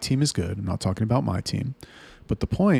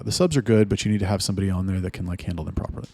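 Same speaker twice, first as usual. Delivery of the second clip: ordinary talk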